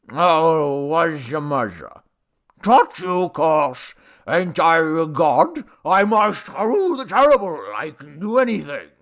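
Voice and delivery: loud, slow slur; drunken boastful god. A narrator's reading. Drunk god boasting of powers to the man, slurred. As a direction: slur it with tipsy grandeur.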